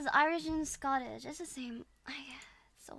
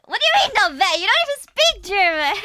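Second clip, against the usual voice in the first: high, loud mock protest breaking into laughter; mock outrage; 8 semitones higher, 14 dB louder, faster than usual. Challenging whoever rated the German, loud laughing mock outrage. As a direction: protest loudly, half laughing.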